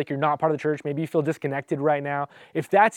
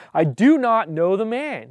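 higher, emphatic acted oath; insistent, raised-pitch denial; sworn denial; 6 semitones higher, 4 dB louder than usual. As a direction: swear it with pressure on each word. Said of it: "invoke a curse on himself and to swear".

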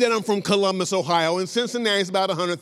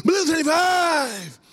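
quarterback cadence call; 10 semitones higher, slower than usual. Retold or imitated. Quarterback calling the snap count at the line. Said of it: "I'm a quarterback, like".

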